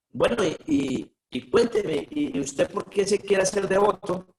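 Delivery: lightly voiced president asking kindly; a touch of the temple president. Temple president questions him.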